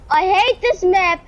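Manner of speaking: ordinary talk, nothing marked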